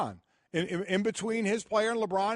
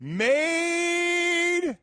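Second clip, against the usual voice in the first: suggestive; 10 semitones higher, 4 dB louder than usual. Real, not acted. Teasing suggestive emphasis.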